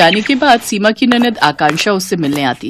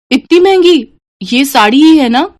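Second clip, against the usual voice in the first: much higher, louder gasp at the price; shocked; 7 semitones higher, 3 dB louder than usual. Acted. Daughter shocked at sari price, high disbelieving squeal.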